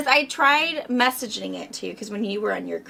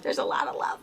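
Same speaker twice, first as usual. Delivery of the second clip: joking whisper; 6 dB quieter than usual. Real, not acted. Joking about grandchild's squeezing.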